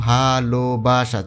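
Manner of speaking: ভালোবাসা in four separate pulses, ভা-লো-বা-সা; ভালোবাসা with a small break after each syllable. Shown as a demonstration. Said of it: "চারবারে উচ্চারণ হয়".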